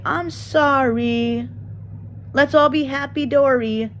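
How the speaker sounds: high, slow, sugary mock apology; mocking a fake sorry; fake facade apology. Streamer mocking fake apology to viewers, high, slow and sugary. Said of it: "do a fake facade".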